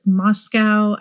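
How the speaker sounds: Moscow said MOSS-kow; spelling pronunciation. Shown as a demonstration. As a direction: rhyme the end of Moscow with "cow". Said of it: "given that it's spelled like".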